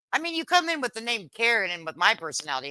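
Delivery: ordinary delivery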